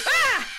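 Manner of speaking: sharp high yelp of fright; sudden frightened shriek; startled yelp. Real, not acted. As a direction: jolt out a scared cry. Jump scare in horror game.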